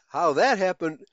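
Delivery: ordinary delivery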